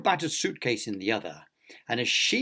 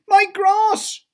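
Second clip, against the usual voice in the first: very high, loud wail; anguished cry; 11 dB louder than usual. A narrator's reading. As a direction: cry out in comic dismay. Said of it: "cried Mister Fussy".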